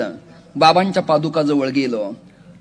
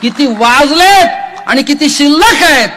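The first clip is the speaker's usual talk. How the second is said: preaching at full force; 10 semitones higher, 9 dB louder than usual. Acted. Kirtankar preaching to the gathered devotees, high and loud. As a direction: lift the line out to the whole crowd.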